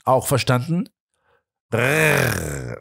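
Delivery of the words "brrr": a short buzzing brrr like a hung-up telephone; rolled lips telephone buzz; phone end tone buzz. A narrator's reading. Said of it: "ertönte das Schlusszeichen".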